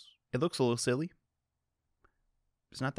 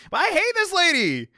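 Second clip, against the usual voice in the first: much higher, shrill accusing yell; outraged accusation, pitched way up; high indignant outburst. Acted. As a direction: point the finger with all your might. Voices a furious character.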